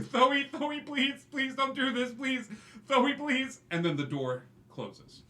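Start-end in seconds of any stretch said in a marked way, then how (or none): 0.0-3.6 high, loud, begging Thoe by name; desperate pleading. Acted. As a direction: cry out to Thoe not to leave you. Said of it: "you hear".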